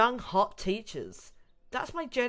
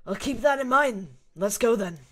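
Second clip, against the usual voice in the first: louder gruff soldier voice; Krauser voice; 3 semitones higher, 8 dB louder than usual. Acted. Krauser cutting the talk short with Ashley, curt and gruff. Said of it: "the Krauser voice".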